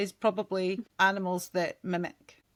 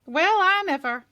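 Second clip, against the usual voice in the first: hammed-up southern US accent, higher; terrible accent; 6 semitones higher, 4 dB louder than usual. Reading out Alabama from a search, terrible southern accent. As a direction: put on a bad Alabama accent. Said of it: "That was a terrible accent".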